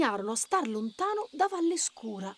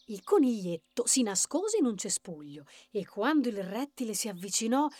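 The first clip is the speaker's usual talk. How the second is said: ordinary delivery, nothing marked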